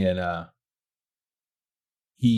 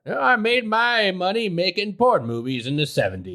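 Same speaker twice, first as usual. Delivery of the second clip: lightly put-on voice, higher; mock old man; 8 semitones higher than usual. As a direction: joke voice of the neighbor bragging. Mocking imagined neighbor confession.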